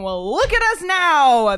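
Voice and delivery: ordinary talk, nothing marked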